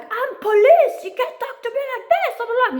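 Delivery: police officer leaving his car to scold her, parodied high and loud; mock pompous cop. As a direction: ham up the cop's self-important outrage. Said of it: "He's like".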